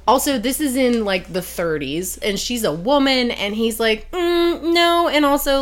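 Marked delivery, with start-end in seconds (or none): 4.1-5.6 high-pitched, condescending brush-off; dismissive refusal. Retold or imitated. Wealthy funder turning down a woman applicant, dismissive. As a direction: lightly mimic a boss turning her down. Boss dismisses her in acted retelling.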